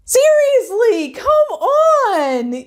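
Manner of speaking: high exasperated groan of disbelief. Real, not acted. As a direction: throw up your hands in disbelief. Exasperated at Megan's denial.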